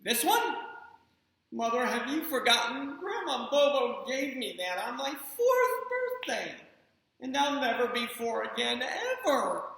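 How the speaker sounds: put-on high, drawn-out theatrical little boy; dramatic child. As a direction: make it a big tragedy, kid. Spencer dramatically defends toy.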